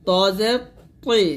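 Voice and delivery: thick t in "ত" and "তৈ", vowel rounded; heavy "তৈ". Shown as a demonstration. Teacher contrasting it with easy ti, heavy "তৈ". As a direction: say "ত জের তৈ" with a thick, full t. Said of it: "মোটা করছে কেন এরকম".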